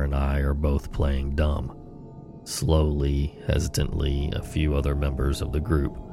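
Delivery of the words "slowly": plain reading voice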